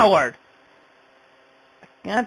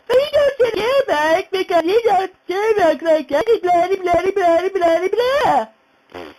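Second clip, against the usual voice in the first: much higher, put-on voice mocking a rival; mocking impression; 9 semitones higher than usual. Vlogger mocking rival Jack Thaddeus, mock high and mighty voice. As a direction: sneer at him by aping his smug voice, pitched way up. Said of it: "he thinks he's all high and mighty with that nine voice".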